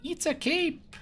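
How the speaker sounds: put-on very high child voice; little boy. Small son showing off his outfit to father, very high child voice. Child in game answers parent.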